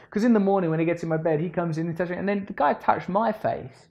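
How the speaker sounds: high, loud silly voice of the dad; a goofy high impression of the dad; imitating the dad. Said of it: "He goes".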